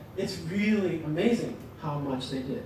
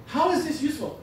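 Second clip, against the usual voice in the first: ordinary talk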